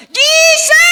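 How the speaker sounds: high, loud cry of Jesus; shout. Retold or imitated. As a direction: scream the name for help. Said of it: "sinigaw niya".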